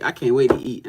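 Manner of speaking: normal talking voice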